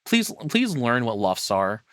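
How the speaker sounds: normal talking voice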